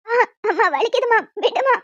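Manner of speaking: put-on girl cries out as her mother grabs her; crying out in pain. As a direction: beg her mother to let go. Daughter hurt, cries out.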